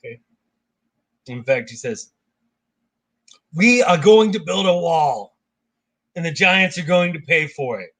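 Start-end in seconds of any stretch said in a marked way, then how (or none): 3.5-7.9 awful impression. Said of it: "that was an awful impression".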